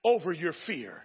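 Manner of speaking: high, loud preacher's cry; rally the congregation with a shout; fired-up pulpit call; preaching at full force. Acted.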